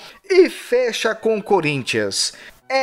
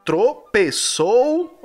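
"Tropeçou" slowly, syllable by syllable: tro-pe-çou; sounding out words; much slower than usual. Shown as a demonstration. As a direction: spell out "Tropeçou" slowly, one syllable at a time. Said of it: "falar sílaba por sílaba". ("Tropeçou" dito devagar, sílaba por sílaba)

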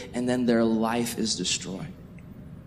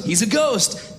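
mock alarm; 9 semitones higher, 3 dB louder, slower than usual. Acted alarm of the disciples.

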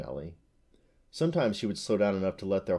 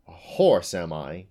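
scornful, loud punch on "horse"; snorted; 2 semitones lower, 7 dB louder, slower than usual. A narrator's reading. Unicorn offended at the farmer's mistake, snorting. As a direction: hit it indignantly, then drop away. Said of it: "she snorted".

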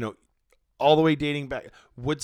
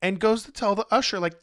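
ordinary talk, nothing marked

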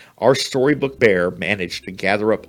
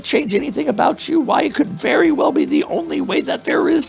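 extremely high, louder squeaky mouse voice; wailing mouse; 5 dB louder than usual. Acted. Mouse warning the bear against new stuffing, high wailing squeak. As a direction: wail it up high like a frightened mouse. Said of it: "the mouse wailed".

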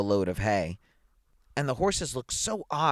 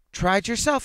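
high-pitched indignant retort; crying out; 10 semitones higher, 4 dB louder than usual. A narrator's reading. Burned man challenging the woman, crying out. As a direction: call it out, pitched well up. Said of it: "he cried".

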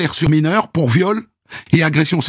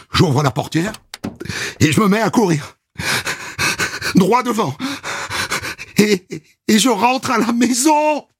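ordinary talk

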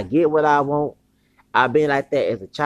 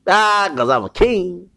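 slow, loud, high kingly declaration; proud boast; 5 semitones higher, 6 dB louder, slower than usual. Real, not acted. Man declaring himself a king, slow, loud and proud. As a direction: proclaim it like royalty.

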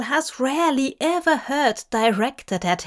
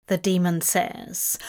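usual speaking voice

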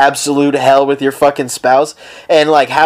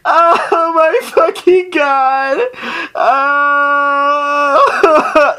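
high, slow hammed-up wail; fake-cry it out alone at home; mock sobbing; 11 semitones higher, much slower than usual. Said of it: "and you're gonna go".

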